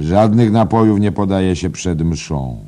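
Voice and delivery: loud, high-pitched proclamation; cried out. A narrator's reading. Editor forbidding drinks before mass, crying out. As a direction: lay down the rule at full voice. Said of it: "zawołał naczelny".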